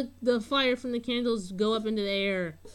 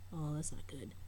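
hushed self-critique; whispered aside; 6 semitones lower, 10 dB quieter than usual. Real, not acted. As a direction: whisper it to yourself. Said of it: "unvoiced whisper".

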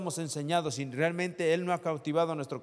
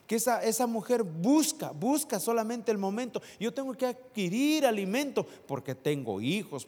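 higher, louder, quick preaching; rally the church with urgency; preaching at full force; 8 semitones higher, 5 dB louder than usual. Acted. Pastor urging the church to prepare, preaching at full force.

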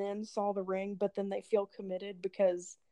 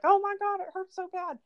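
hammed-up fake pain; 9 semitones higher, 4 dB louder than usual. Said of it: "if you grab your shin and be like".

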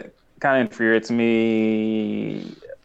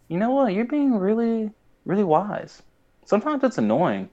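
high mock Sokka; 11 semitones higher than usual. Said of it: "Sokka's like".

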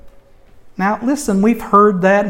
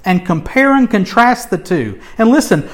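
usual speaking voice

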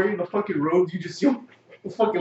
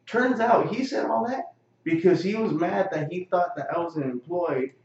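usual speaking voice

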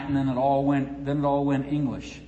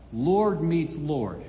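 slow, weighty title; 3 semitones lower, much slower than usual. Acted. Announcing the topic, drawn out.

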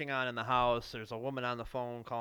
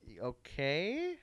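hesitant okay; 3 semitones higher, much slower than usual. Retold or imitated. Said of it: "I'm like".

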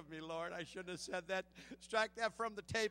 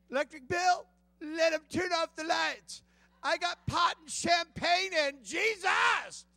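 high, loud, very slow proclamation; shouted boast; 7 semitones higher, 13 dB louder, slower than usual. Retold or imitated. Preacher shouting his old life's boast to the church, loud and slow. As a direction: bellow it like a proud confession.

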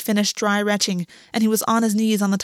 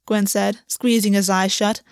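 ordinary delivery

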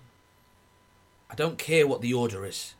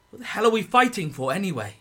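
angry outburst; 7 semitones higher, 6 dB louder, faster than usual. Acted. Soldier's angry outburst against war.

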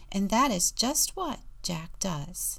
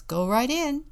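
higher, louder light quote; quoting the guard; 5 semitones higher, 5 dB louder than usual. Retold or imitated. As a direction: retell the guard's welcome lightly. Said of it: "the guard says".

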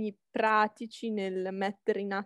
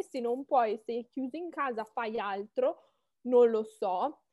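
usual speaking voice

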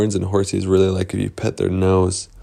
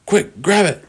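high, hurried command; urgent; 11 semitones higher, 4 dB louder than usual. Acted. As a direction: blurt it out with sudden urgency. Boys urgently grab the bottle.

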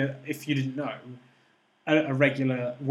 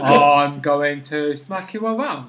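slow, loud dim-witted lug; really dumb voice; 8 dB louder, much slower than usual. Acted. Imagining the villain threatening Jedi, slow dumb voice. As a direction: say it like a slow-brained thug. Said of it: "sounded really dumb".

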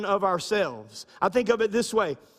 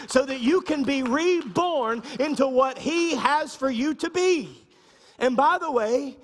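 higher, louder, rising preacher's cadence; full preaching; 7 semitones higher, 4 dB louder than usual. Acted. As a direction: preach it hard to the congregation, building.

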